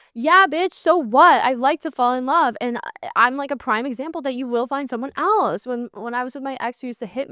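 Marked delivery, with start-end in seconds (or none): 0.1-1.4 louder, higher sassy retort; yeah, so what, in a sassy higher voice; defiant comeback. Real, not acted. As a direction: toss it back with attitude.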